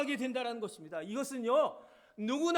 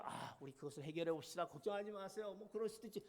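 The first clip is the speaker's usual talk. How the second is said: calm reassuring; 4 semitones lower, 11 dB quieter than usual. Acted. Contrasting calm peacemaker voice.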